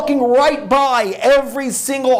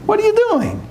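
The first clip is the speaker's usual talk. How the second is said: sudden much higher mocking taunt; Satan's high taunt; 11 semitones higher, faster than usual. Satan mocking a praying man, high jeering. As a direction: jump up high and jeer at him. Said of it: "he's like".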